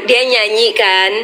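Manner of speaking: usual speaking voice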